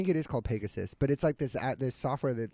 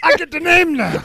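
much higher, louder through laughter; laughing punchline; 14 dB louder than usual. Real, not acted. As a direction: squeal it while cracking up. Laughing punchline after joke.

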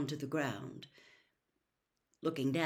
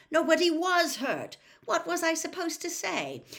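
frustrated; 12 semitones higher, 7 dB louder than usual. A narrator's reading. Arthur frustrated defending himself.

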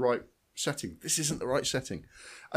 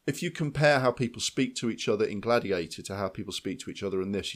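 ordinary talk, nothing marked

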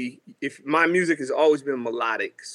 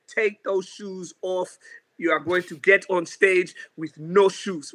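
slow, higher African-accented mom impression; African mother's accent; 3 semitones higher than usual. Mom ordering her child before a festival show, African accent.

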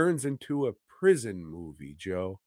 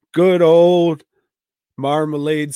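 loud; 5 semitones higher, 11 dB louder than usual. Retold or imitated. Building up to the punchline.

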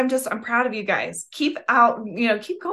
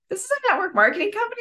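surprised customer; 10 semitones higher, much faster than usual. Retold or imitated. Retold text from surprised customer.

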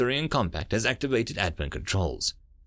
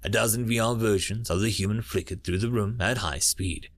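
ordinary delivery, nothing marked

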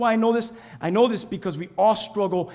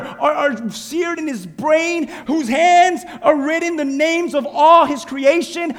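loud, higher, slowed preaching cadence; full-force preaching; 4 semitones higher, 6 dB louder, slower than usual. Acted. Pastor preaching to the congregation, full-force sermon voice. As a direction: preach it out with rising fire.

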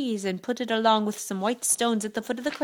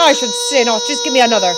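loud, defiant giant; shouting giant; 2 semitones higher, 10 dB louder, faster than usual. A narrator's reading. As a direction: shout it with wounded pride. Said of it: "shouted Cuchulainn".

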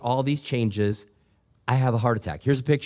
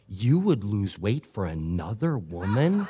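lower, softer, slowed wife's voice through laughter; low mock-offended wife, laughing; wife's indignant question; 5 semitones lower, 4 dB quieter than usual. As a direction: let the wife's disbelief land low and slow. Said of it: "she said".

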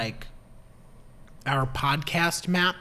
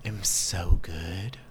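low, slow, breathless purr; breathy phone-sex voice; 9 semitones lower, slower than usual. Pretend. Co-host teased about a sex hotline, breathy and low. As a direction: sink low and draw it out breathily. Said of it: "you're breathing kind of funny".

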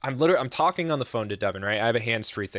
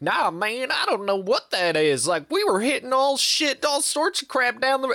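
put-on twangy accent; 12 semitones higher than usual. Stranger denying he knows what the debris is, high twangy accent. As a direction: mimic a twangy roadside stranger. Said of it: "he had, like, the best accent".

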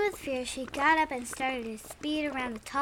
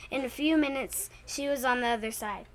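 ordinary delivery, nothing marked

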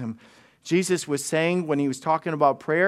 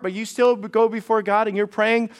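ordinary talk

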